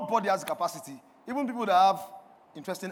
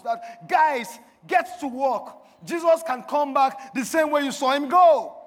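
raised, punchy pulpit voice; forceful preaching; 5 semitones higher, 8 dB louder than usual. Real, not acted. Preacher challenging the congregation about Jesus coming now, forceful preaching. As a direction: press each question on the congregation, loudly.

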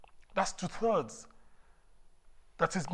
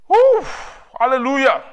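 very high, loud joyful whoop; ecstatic shout; 9 semitones higher, 17 dB louder than usual. Real, not acted. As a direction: whoop with joy.

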